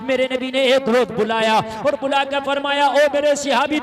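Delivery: loud, ringing sermon delivery; fiery preacher's call; preaching at full force. Acted. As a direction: thunder it to the gathering.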